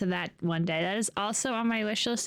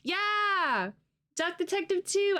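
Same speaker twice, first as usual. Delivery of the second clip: excited; 12 semitones higher than usual. Real, not acted. Excited about sequel game.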